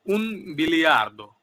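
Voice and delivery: un biliardo drawn out very slowly and loud; slow Italian number. Shown as a demonstration. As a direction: stretch out un biliardo, each syllable clear. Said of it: "exceptional". (ধীরে ও জোরে un biliardo)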